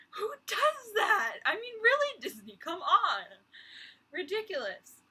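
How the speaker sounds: high, loud, drawn-out disbelief; real exasperation. Real, not acted. Fan scolding Disney over a needless new land, exasperated. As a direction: call them out, loud and incredulous.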